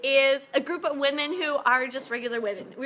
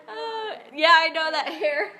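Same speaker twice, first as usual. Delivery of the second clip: laughing; 5 semitones higher, 8 dB louder than usual. Real, not acted. Laughing fit with friend.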